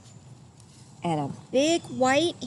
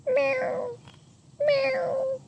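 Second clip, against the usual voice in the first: much higher, thin cat meow. A narrator's reading. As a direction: mew like a little cat. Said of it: "a tiger tomcat".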